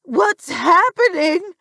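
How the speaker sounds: high, loud, drawn-out panicked cry; screamed question. A narrator's reading. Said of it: "My mother screamed out".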